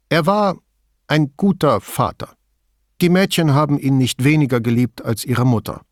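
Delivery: terse middle-aged woman, wary; Signora Toso voice. A narrator's reading. Toso speaks.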